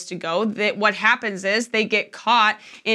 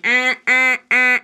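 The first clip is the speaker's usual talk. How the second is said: nasal eh eh alarm noise, a bit louder; imitated alarm beep; 4 dB louder than usual. Pastor imitating a morning alarm, mock beeping. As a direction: mimic a blaring alarm clock. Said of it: "their alarm goes off like".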